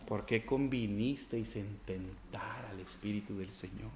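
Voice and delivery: slow, soft, grave reading; slow hushed sermon reading; solemn scripture reading. Acted. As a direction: draw out Peter's rebuke gravely.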